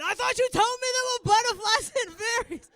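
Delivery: high, loud accusing cry; outraged child. Acted. Daughter confronting mother at toilet, outraged cry. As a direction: wail it as a betrayed kid. Daughter upset at being lied to.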